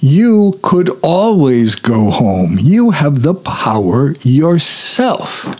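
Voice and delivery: slow, lilting good-witch imitation; Glinda. Good witch consoling stranded Dorothy, slow sing-song imitation. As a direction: draw it out high and swooping, mock reassurance. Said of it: "So then Glinda says".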